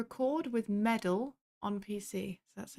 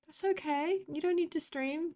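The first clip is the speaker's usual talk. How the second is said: high, gentle and reassuring; sweet reassurance; 8 semitones higher, 4 dB louder than usual. Real, not acted. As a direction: comfort them sweetly. Sweetly reassuring a chat message.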